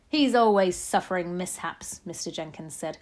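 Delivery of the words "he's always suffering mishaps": loud, dismissive father; blustering father. A narrator's reading. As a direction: play a father loudly complaining.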